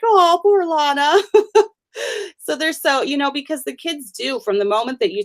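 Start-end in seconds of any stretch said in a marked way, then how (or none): 0.0-1.6 high, slow, said through laughter; laughing. Retold or imitated. Mother pitying her daughter, laughing. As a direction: chuckle out the pity.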